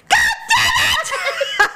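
very high, drawn-out shriek with laughter; hammed-up screech of frustration; mock yell. Colleagues mimicking her unmuted outburst, drawn-out mock shriek.

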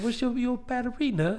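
put-on high little kid with a British accent. Little boy asking friend's mom, innocent British accent. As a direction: ask it as a small British boy. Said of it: "with a British accent".

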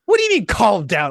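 high indignant mock anger; mock angry retort. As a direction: protest like a furious patient. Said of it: "I was really mad".

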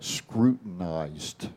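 drawn out slowly, syllable by syllable; slow deliberate word. Shown as a demonstration. Announcing his word of the day to the hosts, slow and deliberate. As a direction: savor each syllable of the big word. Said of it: "I'm gonna give my word for the day".

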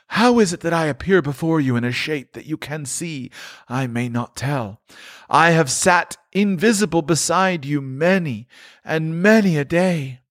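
high, solemn ghostly lament; a tormented spirit grieving over his wasted life; mournful ghost, pitched high; Marley's ghost. Acted.

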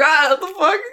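high-pitched incredulous laugh-talk; retold laughing disbelief. Reacting to the ex's parting confession, laughing disbelief. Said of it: "I just started laughing".

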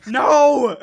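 high, loud cry of dismay; hammed-up wail of protest; mock dismay. Podcaster learns laughter went unrecorded, mock-dismayed cry.

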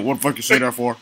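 quiet, deep-pitched mock complaint; man in the gym. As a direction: drop your voice like the gym guy. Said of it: "you like my man in the gym".

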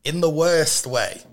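normal talking voice

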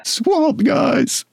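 high croaky swamp frog; lightly voiced frog creature. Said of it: "my frog voice is not quite it used to be".

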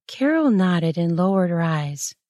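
ordinary delivery, nothing marked

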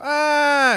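high mock scream; Kevin McCallister scream. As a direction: imitate a startled movie-kid scream at moderate volume. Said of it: "clap your hands against your cheeks".

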